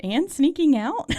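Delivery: surprised. Retold or imitated. Mock gasp quoting the scene.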